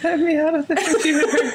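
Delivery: high, plaintive trapped voice; mock-pitiful high plea; creepy voice. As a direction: plead in eerie falsetto from inside a machine. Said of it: "creepy voice for, like, three minutes".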